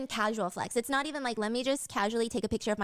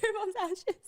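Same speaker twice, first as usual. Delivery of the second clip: laughing punchline; 7 semitones higher than usual. Real, not acted.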